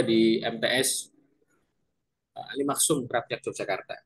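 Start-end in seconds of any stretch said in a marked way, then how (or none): none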